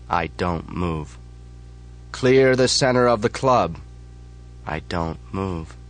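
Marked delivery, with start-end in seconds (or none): 2.1-3.8 loud firm repeated order; leader's call again. Acted. Chapter leader repeats the order, loud firm. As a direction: repeat the command, harder. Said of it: "yells the voice".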